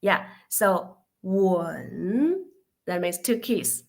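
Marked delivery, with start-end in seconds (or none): 1.2-2.5 WEN dipping low, then rising; long drawn WEN that sinks and swings up; third tone. Shown as a demonstration. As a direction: let WEN fall, then lift it at the end. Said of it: "if we pronounce the third tone".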